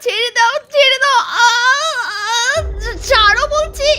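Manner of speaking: crying to be let go, high; panicked. Acted. Wife seized by the ghost, sobbing in panic. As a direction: struggle and plead in terror.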